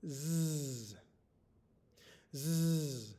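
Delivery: "Zzz" as a long voiced buzz; isolated letter sound. Shown as a demonstration. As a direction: hold the z of "Zzz" steadily. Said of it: "we can make this sound".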